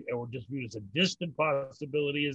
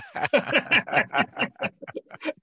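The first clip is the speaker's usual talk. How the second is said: high, breathy burst of laughter at his own punchline; real laugh; 9 semitones higher than usual. Real, not acted. Rabbi laughing at the joke he just told, high real laughter.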